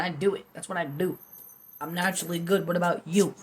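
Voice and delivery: normal talking voice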